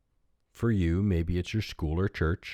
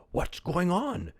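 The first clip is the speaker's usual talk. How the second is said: higher, slow, puzzled villager; lightly voiced villager; 11 semitones higher, slower than usual. A narrator's reading. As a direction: ask the old man what the noise is. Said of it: "inquired another villager".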